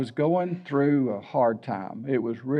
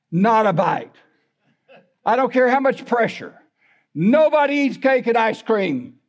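raised, firm parental warning; stern warning to kids; 6 semitones higher than usual. Retold or imitated. Mom warning her kids on the way to the party, stern and raised. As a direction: lay down the law to the kids, high and firm.

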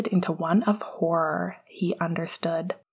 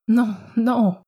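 gasped; 4 semitones higher, 6 dB louder than usual. Acted. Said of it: "Sirius gasped".

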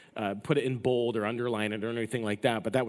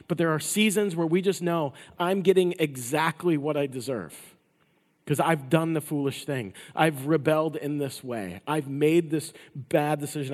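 louder, driving sermon delivery; forceful preaching; 4 semitones higher, 5 dB louder than usual. Acted. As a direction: preach it hard to the congregation.

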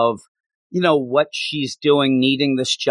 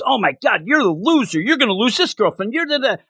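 higher, mocking scold at the loser grandson; mock berating relatives; 8 semitones higher than usual. Relatives scolding Kazuya in a mocking, higher voice. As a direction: jeer at him like his nagging family.

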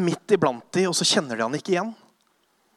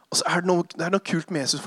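normal talking voice

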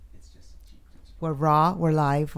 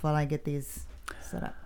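a whisper just off the mic; whispered aside; 6 dB quieter than usual. Real, not acted. Host fixing headphones tells co-host: whispered aside. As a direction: breathe it out as a quick whisper.

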